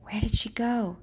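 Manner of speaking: breathy whisper, searching the woods. Acted. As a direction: whisper it, afraid she is gone.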